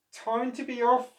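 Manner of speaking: high, sheepish creature; octopus voice. Acted. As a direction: slip away apologetically. Octopus leaving.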